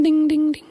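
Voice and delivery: high little metallic pings, fading; sing-song falling-object dings; imitated dinging. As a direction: mimic a ring rattling down a duct. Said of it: "I heard it go ding".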